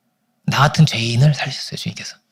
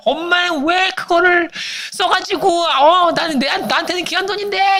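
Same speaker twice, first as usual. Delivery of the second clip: high, drawn-out mock wail of complaint; mock whining son; 13 semitones higher, slower than usual. Son complaining to mother about spent keepsake money, mock whining. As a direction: whine it way up high, hammed-up.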